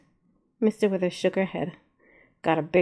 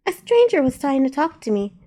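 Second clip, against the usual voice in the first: put-on little girl voice; 6 semitones higher, 5 dB louder than usual. A narrator's reading. Little girl Zena explains.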